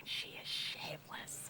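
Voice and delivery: hushed unvoiced aside; whisper. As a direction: whisper it to a friend, scandalized. Friends commenting behind her back.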